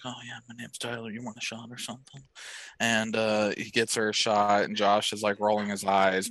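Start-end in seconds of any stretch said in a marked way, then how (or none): 0.0-2.2 soft, fast, offhand pickup line; quick, hushed, casual come-on; cool guy act. Acted. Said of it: "he's like".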